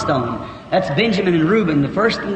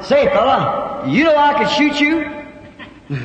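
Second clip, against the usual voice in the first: slow, high-pitched playful warning; drawn-out challenge, as if calling to a bird; mock threat; 6 semitones higher, much slower than usual. Acted challenge to the eagle.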